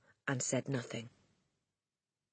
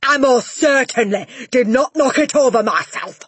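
loud, much higher indignant roar; roaring denial; 10 semitones higher, 13 dB louder than usual. Acted. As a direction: bellow the denial back at the children. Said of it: "roared the Trunchbull".

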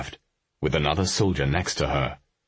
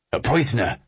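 soldier voice; 7 semitones higher than usual. A narrator's reading. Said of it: "one of the soldiers said".

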